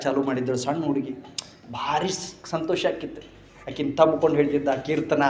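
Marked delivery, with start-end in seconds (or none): 1.7-5.2 soft, low, grave telling of a death; quiet mournful narration; hushed storytelling. A narrator's reading. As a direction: drop the voice to a hush for the sad part. Tells father's death softly.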